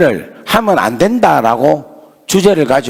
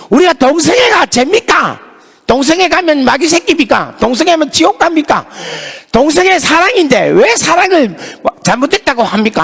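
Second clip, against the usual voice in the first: retold students' protest; 9 semitones higher than usual. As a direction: hammer the questions back at him. Students shout back at pastor.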